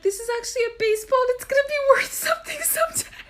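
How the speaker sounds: put-on high pleading kid voice. Kid explaining the baseball to mom, high and pleading. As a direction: plead like a hopeful child.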